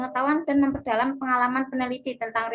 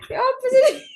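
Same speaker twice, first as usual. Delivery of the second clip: breaking character giggling; 12 semitones higher, 5 dB louder than usual. Real, not acted.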